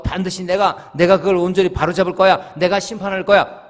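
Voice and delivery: loud, pushy mock vow of taking matters into his own hands; loud imitation of a stubborn inner resolve; self-righteous vow. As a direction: declare it like a man who will fix everything himself.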